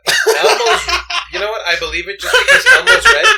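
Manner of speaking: high, loud, talking through laughter; barely holding it together; laughing. Real, not acted. Cracking up at the joke.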